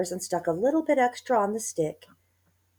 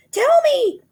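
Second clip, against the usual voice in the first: very high, very loud terror; panicked old wife; high loud terrified cry; frightened cry; 11 dB louder than usual. A narrator's reading. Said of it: "she cried".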